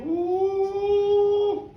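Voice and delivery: high, sustained "ooh"; awed drawn-out ooh; long startled ooh. Acted. Hamish startled as witch vanishes.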